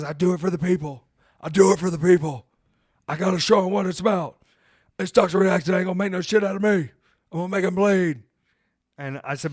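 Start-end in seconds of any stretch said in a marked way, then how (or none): 0.0-1.0 tough wrestler. Retold or imitated. Voicing Mance, fired up. 1.4-2.5 louder proud wrestler. Retold or imitated. Repeated louder. 3.1-8.2 tough wrestler. Retold or imitated. Voicing Mance, fired up.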